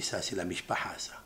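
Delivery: hushed, breathy aside; awed whisper of recognition. Acted. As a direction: whisper it like a secret discovered. Yosef's hushed realisation.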